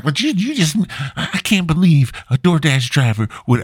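put-on incredulous stammer; mock indignant. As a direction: imitate a woman stammering in disbelief. Retold homeowner sputters in disbelief.

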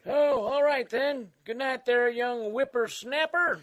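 put-on high, slow cranky old man; creaky old-timer voice; Old Man Dan. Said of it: "Old Man Dan".

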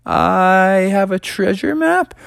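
high, slow nervous answer; acted small, hesitant voice; timid. Timid reply to frightening voice.

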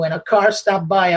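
normal talking voice

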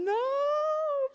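high, piercing cry of no; little girl wailing no at her father; retold child's scream. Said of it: "Puis j'hurle, j'hurle".

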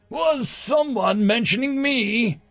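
high, slow, showy call-out; swaggering call. A narrator's reading. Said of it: "calling out".

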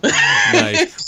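loud, high delighted "nice"; excited. Real, not acted. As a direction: burst out with delight. Reacting with delight.

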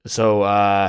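ordinary delivery

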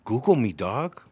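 loud, swooping imitation of a serious boast; mock dead serious. Imitating a man in the studio, mock dead serious. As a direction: imitate someone demanding it in all seriousness. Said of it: "looked at him dead serious and goes".

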